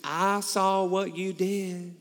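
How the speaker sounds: thick, drawn-out southern drawl; slow southern accent, stretching the vowels. Retold or imitated. Elderly lady praising a good deed, heavy southern accent. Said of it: "in the most southern accent".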